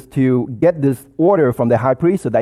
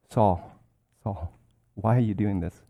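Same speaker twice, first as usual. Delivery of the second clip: much softer, calm plea; quiet your storm and speak gently; softly calming an angry man; soft answer; 4 semitones lower, 8 dB quieter than usual. Shown as a demonstration. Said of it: "Someone needs to stop, breathe, and say".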